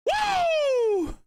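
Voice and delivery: very high, drawn-out woo; jubilant whoop. Real, not acted. Commentator revels in the early goal, jubilant whoop. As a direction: let out a long high cheer.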